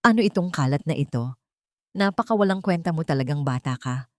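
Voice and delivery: plain reading voice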